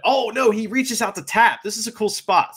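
ordinary delivery

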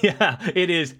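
ordinary delivery

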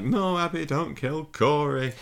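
high, drawn-out mock pleading; mock plea. Recapping Jack begging Abby, in a mock high plea.

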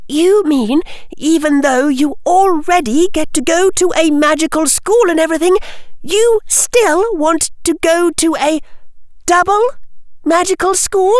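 high disbelieving questioning; incredulous schoolgirl. Acted. Hermione asking about a double magical school, incredulous.

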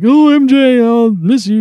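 high, loud lovesick mock voice; mock sappy Peter. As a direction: ham it up like a lovesick teen. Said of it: "he has, like, this".

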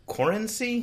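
"Corency" pitched high, as a question; questioning "Corency", checking the name with a co-host; unsure pronunciation. Real, not acted. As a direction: try out "Corency" uncertainly, tune lifted high. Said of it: "How did you say his name?".

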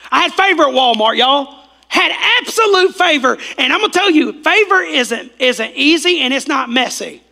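loud, high, excited preaching; preaching at full force. Real, not acted. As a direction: pendeta berseru lantang dan gembira. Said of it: "preaching at full force".

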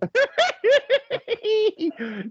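high burst of laughter. Real, not acted. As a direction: cackle at the bickering. Host laughs at argument.

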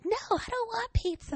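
put-on very high, soft kid's whine; imitating a sulking girl arguing about pizza; bratty child protest. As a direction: pout it like a little sister. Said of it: "She's like".

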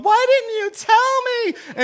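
high, loud, drawn-out wail; mock accusing scream. Jennifer screaming at Jessica, hammed-up accusing scream. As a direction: imitate a doomed girl shrieking slowly. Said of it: "And she's screaming at Jessica".

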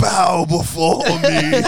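slow, high, booming command; megalomaniac rant. Pretend. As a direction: demand worship like a tyrant. Mock king commands rival.

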